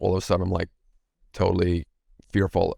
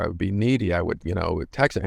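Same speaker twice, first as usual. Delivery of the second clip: ordinary talk, nothing marked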